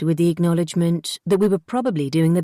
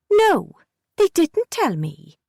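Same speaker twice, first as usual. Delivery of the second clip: put-on high, curt child's denial; a kid insisting flatly; pitched-up stubborn no; sulky girl; 9 semitones higher, 4 dB louder, slower than usual. A narrator's reading.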